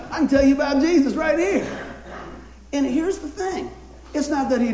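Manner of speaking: laughing while talking. Real, not acted. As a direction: chuckle through the line. Laughing through joke.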